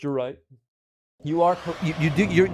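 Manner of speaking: ordinary talk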